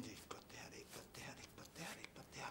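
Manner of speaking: repeated frightened whispering; panicked whisper. Acted. Man alone with the body, panicked whisper. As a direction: whisper it over and over.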